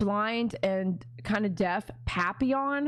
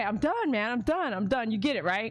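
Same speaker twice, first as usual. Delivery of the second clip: ordinary talk, nothing marked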